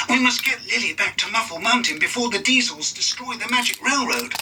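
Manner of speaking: a touch of worried urgency; Percy. A narrator's reading. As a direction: hurry the others along.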